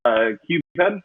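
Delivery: usual speaking voice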